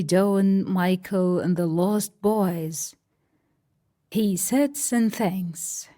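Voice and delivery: usual speaking voice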